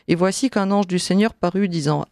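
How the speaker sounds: ordinary talk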